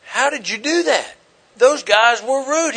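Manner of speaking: a stunned rider who can't believe it; amazed passenger. Retold or imitated.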